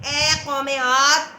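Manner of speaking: loud, ringing summons; voice from heaven. A narrator's reading. A voice from the sky addressing Aad, loud summons. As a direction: call out loudly to a whole nation. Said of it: "آسمان سے ایک آواز آئی".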